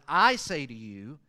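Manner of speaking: "I" loud and drawn out; slow phrase leaning hard on "I"; stress on I. Shown as a demonstration. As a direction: make "I" the word that counts. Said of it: "obviously he emphasizes".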